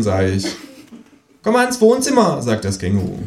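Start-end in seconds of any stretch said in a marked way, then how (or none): 1.4-2.4 high, loud cheeky kangaroo. Acted. Kangaroo demanding the cinema, high and loud.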